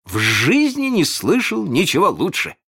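rapturous sidekick's praise; delighted exclamation. A narrator's reading. Smee praising Hook's cunning plan, with delight. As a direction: gush in delighted admiration. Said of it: "слушал его с восторгом".

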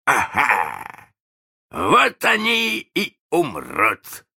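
high, drawn-out villainous glee; merry gloating. A narrator's reading. Hook exulting that the boys will die, merrily gloating. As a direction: crow with slow, merry menace. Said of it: "смех его звучал очень весело".

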